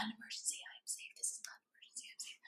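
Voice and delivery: whispered mantra, no voice. Shown as a demonstration. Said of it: "Saying them out loud while whispering".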